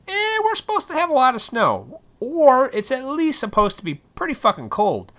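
high-pitched, loud complaint; exasperated rant. Real, not acted. Ranting to listeners about Illinois winters, exasperated and high.

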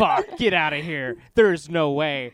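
slow, loud stunned disbelief; disbelieving shock. Retold or imitated. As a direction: say it like you can't believe your ears. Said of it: "I was like".

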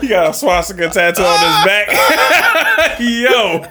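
loud, high and drawn out through laughter; laughing hard. Real, not acted. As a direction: shout the punchline while cracking up. Topping the joke while cracking up.

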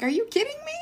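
half-mock incredulous squeak; shocked disbelief. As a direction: gasp it out in shock. Mother's shocked disbelief retold.

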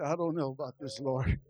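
hushed anxious prayer; worried Moses. Acted. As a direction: murmur doubts quietly to the Lord. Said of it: "I said".

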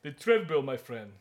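lightly put-on African accent for a friend's quote; imitating a thick African accent. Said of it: "talking like a real thick African accent".

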